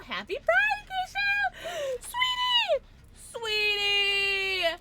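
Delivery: very high, loud squeal of greeting; excited shout. Real, not acted. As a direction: shriek their names to greet them. Calling out to chat friends.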